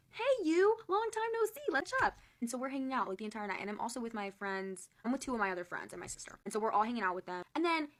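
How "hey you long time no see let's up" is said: very high, chirpy mock hello; exaggerated long-time-no-see squeal; hammed-up greeting. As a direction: put on an over-friendly high voice. Acts out her greeting to him.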